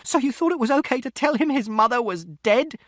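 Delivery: very high, loud, accusing yell; outraged shout. A narrator's reading. Mother to father about the lie of her death, outraged shout. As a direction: hurl it at him. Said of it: "And Mother shouted".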